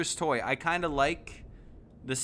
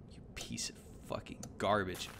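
whispered, much lower and softer insult; whispered curse; 9 semitones lower, 10 dB quieter than usual. Real, not acted. Gamer cursing a bad result under his breath, whispered. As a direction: hiss it under your breath.

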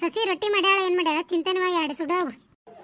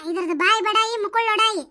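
ordinary delivery

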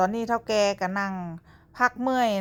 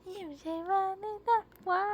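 high, soft cooing; 10 semitones higher, 4 dB quieter than usual. Real, not acted. Parent coaxing the baby to smile, high soft cooing.

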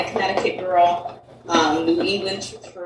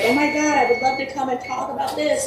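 mock excitement, pitched up; higher, lightly acted enthusiasm; eager guest; 6 semitones higher than usual. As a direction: imitate someone keen to come on the show. Voices eager would-be podcast guests.

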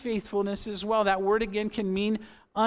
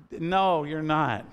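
ordinary talk

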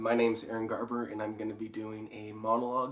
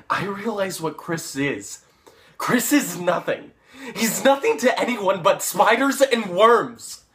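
loud, high, contemptuous shouting; angry outburst; 8 semitones higher, 12 dB louder, slower than usual. Acted. Paul denouncing Chris as nothing, shouting angrily. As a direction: build to a furious shout.

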